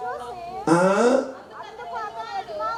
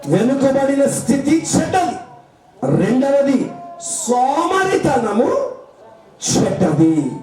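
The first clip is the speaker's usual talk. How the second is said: preaching at full force; 5 semitones higher, slower than usual. Acted. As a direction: proclaim it from the pulpit. Pastor preaching to the church.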